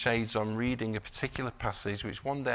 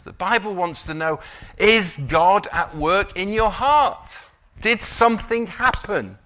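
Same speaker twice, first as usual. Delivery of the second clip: higher, emphatic pulpit delivery; impassioned preaching; 7 semitones higher, 8 dB louder than usual. Real, not acted. Preacher challenging the congregation about evidence of faith, impassioned preaching. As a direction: press each question home to the congregation.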